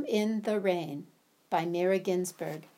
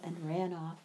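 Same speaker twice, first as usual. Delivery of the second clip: usual speaking voice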